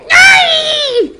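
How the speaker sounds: loud high shriek of frustration; frustrated scream. Acted. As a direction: scream it out in rage. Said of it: "Hermione screamed in frustration".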